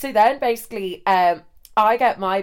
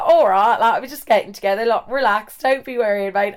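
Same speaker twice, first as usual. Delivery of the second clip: put-on louder laddish English accent; 4 dB louder than usual. English guy calming the angry roommate, exaggerated English lad accent. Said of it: "he was English, so he was like".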